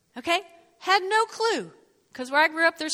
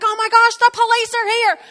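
alarmed; 10 semitones higher, 11 dB louder than usual. Retold or imitated. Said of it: "And I'm like".

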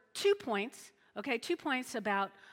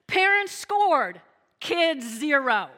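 triumphant; 3 semitones higher, 9 dB louder, slower than usual. Real, not acted. Pastor declaring parents' victory over the pierced teen, loudly. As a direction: announce the score like a win.

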